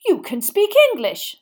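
high, loud indignant exclamation; outraged. A narrator's reading. Minister discovers his guest speaks English, outraged. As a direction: say it in affronted disbelief. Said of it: "sounding outrage".

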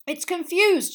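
high, loud teacher's call; excited shout. A narrator's reading. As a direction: shout it over the commotion. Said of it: "shouted Lupin".

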